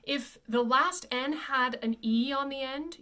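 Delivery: normal talking voice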